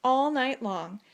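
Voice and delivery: usual speaking voice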